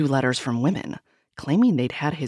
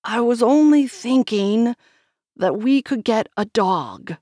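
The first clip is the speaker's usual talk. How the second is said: frustrated; 6 semitones higher, 6 dB louder than usual. A narrator's reading.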